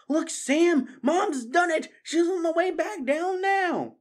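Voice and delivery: high, loud jubilant calling; thrilled parent calling to a child; excited cheer. Acted. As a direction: call out the good news. Father cheering to his child.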